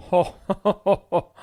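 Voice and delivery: loud, acted belly laugh. A narrator's reading. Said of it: "Archibald started laughing".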